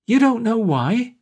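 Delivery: loud, slow, high incredulous cry. Acted. Drunk Wall scoffs at Pat's warning, loud slow cry. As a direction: throw the question back at him, loud. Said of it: "cried Park".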